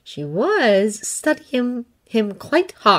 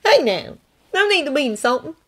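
put-on higher, earnest young Jedi voice; Thonne's voice; 9 semitones higher than usual. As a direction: lift the voice up for Thonne, sincere and pleading.

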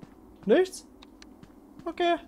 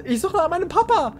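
put-on kid voice; 10 semitones higher, 4 dB louder than usual.

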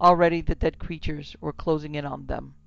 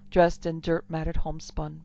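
ordinary delivery, nothing marked

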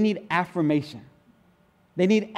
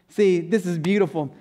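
ordinary delivery

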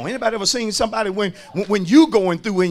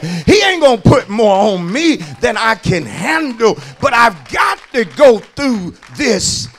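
raised, emphatic pulpit voice; full-force preaching; 2 semitones higher, 6 dB louder than usual. Real, not acted. Preacher assuring the congregation they can hold on, full-force preaching. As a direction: press it home loudly to the congregation.

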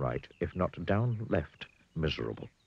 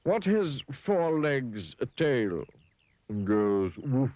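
slow, raised quizzing voice; clever elephant; 7 semitones higher, 4 dB louder, much slower than usual. A narrator's reading. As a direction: pose the riddle slowly, pitched up. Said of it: "said the clever elephant".

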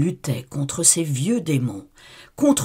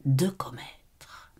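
chuchoté; 7 dB quieter, much slower than usual. A narrator's reading. Horreur, souffle chuchoté.

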